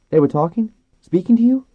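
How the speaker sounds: amazed questioning. A narrator's reading. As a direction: ask in astonishment, voice shooting up. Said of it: "looked at him in blank amazement".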